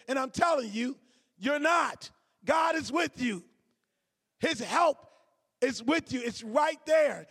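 loud, rising preacher's exhortation; preaching at full force. Acted. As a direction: drive it home to the congregation.

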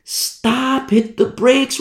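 high, strained yell held back in volume; half-voiced cry of warning; mock scream. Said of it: "I scream at the top of my lungs".

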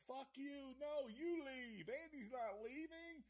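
a hammed-up imitation of a defiant mother; mocking the mom. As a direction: mimic the clingy mom up high and sneering. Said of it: "like, going".

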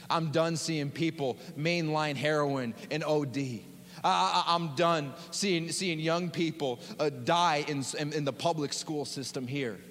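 ordinary talk, nothing marked

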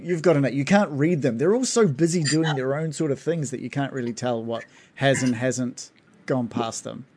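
ordinary talk

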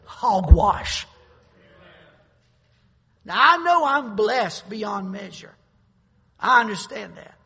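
loud, building sermon delivery, slowing at the end; fiery preaching. Acted. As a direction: preach it hard to the congregation. Preaching at full force.

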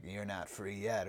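low menacing. Retold or imitated. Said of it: "he's like".

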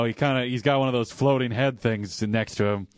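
ordinary talk

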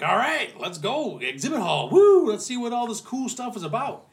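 hammed-up convention excitement; mock eager excitement.

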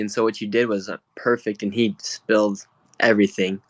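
normal talking voice

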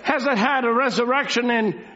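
declamatory, building to pointed questions; emphatic preaching. Real, not acted. Preacher asking believers whether they have it, emphatic preaching. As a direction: press each challenge on the listeners.

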